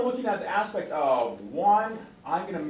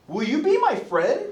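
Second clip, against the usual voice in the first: high, loud, slow sweet asking; eager child; 8 semitones higher, 6 dB louder than usual. Retold or imitated. Kid asking a stranger child to be friends, high eager. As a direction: ask like a small kid making a friend. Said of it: "another kid their age and like".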